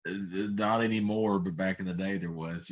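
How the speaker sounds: normal talking voice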